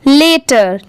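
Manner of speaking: लेटर with ले, an ay sound; correct first vowel. Shown as a demonstration. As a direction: say लेटर starting with a long e. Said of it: "लटर या लाटर नहीं होगी".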